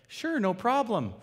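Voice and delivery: high, loud, slow fake-cheerful reply; forced politeness. To the drive-thru worker, forced cheerful politeness. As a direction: grit out a sugary sure. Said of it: "so I just went".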